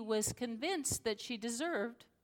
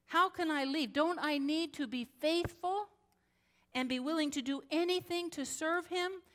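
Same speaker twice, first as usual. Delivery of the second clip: pleading fear; 7 semitones higher than usual. Retold or imitated. Abused woman asks the pastor how she can leave, pleading.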